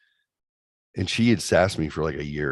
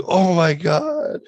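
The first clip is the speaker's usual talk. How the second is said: panicked gasp; 10 semitones higher, 5 dB louder, much slower than usual. Retold or imitated. Girlfriend panics at the garage door.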